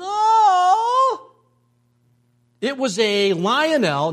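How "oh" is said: high, loud delighted shout; joyful cry. Retold or imitated. Boy opening the Lionel train, loud high joyful cry. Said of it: "I just went".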